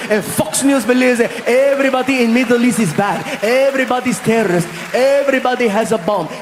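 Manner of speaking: put-on worked-up rant in a Saudi accent; mock-indignant Saudi man, climbing at the end; Saudi local. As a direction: build an exasperated Arabic-accented tirade. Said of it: "meet some of the locals".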